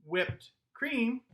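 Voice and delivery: "Whipped cream" as two high, separated beats; syllable by syllable. Shown as a demonstration. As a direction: pause between "Whipped" and "cream". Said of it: "also two".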